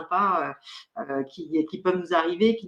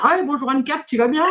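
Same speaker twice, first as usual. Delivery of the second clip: higher, bright-voiced hello from a regular client; cheery client greeting; 7 semitones higher, 4 dB louder than usual. A client greeting Anne-Cat at a consultation, cheery and higher. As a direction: greet her like a happy returning client. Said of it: "tous les clients vont me dire".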